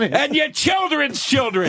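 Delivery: high, slow proclamation; mock curse. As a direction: pronounce a hammed-up curse. Joking pronouncement of a curse.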